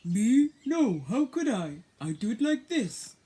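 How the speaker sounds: a hearty horse brushing it off. Acted. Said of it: "he asked the horse".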